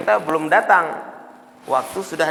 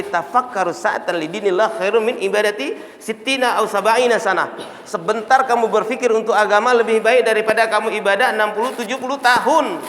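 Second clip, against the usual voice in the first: raised, emphatic sermon quotation; forceful preaching; 6 semitones higher, 5 dB louder than usual. Real, not acted. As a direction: build to a loud, high proclamation. Preaches a saying of the Prophet. (ceramah tegas, makin lantang)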